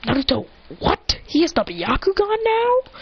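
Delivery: mock surprise, rising high at the end; surprised. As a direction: react to news with a climbing question. Acting surprise at the news.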